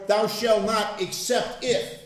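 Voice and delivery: slow, loud sarcastic "except if"; mock sarcastic loophole. Mocking loopholes in scripture, sarcastic and slow. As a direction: drag out the silly loophole.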